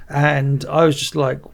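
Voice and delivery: normal talking voice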